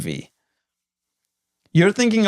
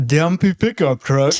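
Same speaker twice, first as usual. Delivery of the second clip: mocking imitation. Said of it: "I don't say it like that".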